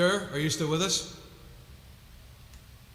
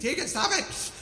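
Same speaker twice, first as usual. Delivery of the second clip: playful, higher-pitched protest; mock scolding; 7 semitones higher than usual. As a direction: scold a friend in jest. Mock scolding of chat member.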